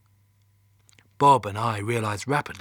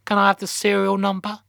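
higher, louder receptionist voice; teenage call-centre girl; 9 semitones higher, 6 dB louder than usual. A narrator's reading. Teenage girl answering Mission Control's phone, high and routine. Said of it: "who sounded in her teens".

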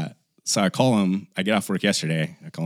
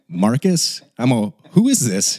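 high, louder baffled question; suddenly suspicious, who is this; higher and louder, startled confusion; confused surprise; 4 semitones higher, 5 dB louder than usual. Retold or imitated. Confused, realizing wrong person.

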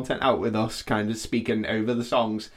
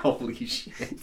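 shocked whisper; 12 semitones higher, 10 dB quieter, much slower than usual. Real, not acted. Shocked laughter after joke.

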